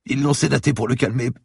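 a beaten lawyer gasping in fear; frightened bleeding man pleading with the cop; terrified captive. Acted. Lawyer continues.

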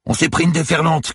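loud, high-pitched yell; urgent cry at sea; fisherman's shout. A narrator's reading. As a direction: shout over crashing waves. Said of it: "cria le pêcheur".

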